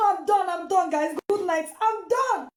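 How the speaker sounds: normal talking voice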